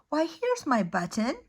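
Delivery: little bear cries out finding his button; excited cry. Acted. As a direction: burst out with delight at the find. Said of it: "he cried".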